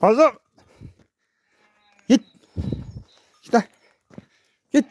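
sharp high shout of the name; calling out. Real, not acted. Vlogger shouting after the goat Mazlum, high loud call. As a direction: yell the name to stop him.

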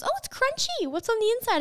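high, curious friend voice; quoting friend. Retold or imitated. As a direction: squeak out a puzzled question. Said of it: "She goes".

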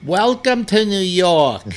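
high, loud mock New York woman; thick New York accent. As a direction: imitate a New York cab announcement lady. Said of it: "she had too much of an accent".

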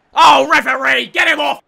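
loud shout at the ref; loud appeal. Real, not acted. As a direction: yell for the ref.

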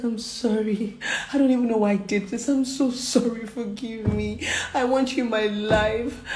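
high, pleading, weepy imitation; tearful apology. Crying lover begging her forgiveness, tearful. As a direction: act out begging forgiveness through tears. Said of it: "He was crying".

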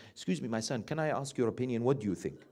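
a hint of exaggerated politeness; mock-polite asking. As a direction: ask the son timidly, as if seeking permission. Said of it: "would you go and say to your son".

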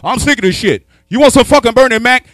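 normal talking voice

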